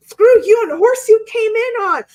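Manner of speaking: high, loud mock blow-up; loud angry outburst. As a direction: shout it like telling someone off. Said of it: "It wasn't loud. She wasn't".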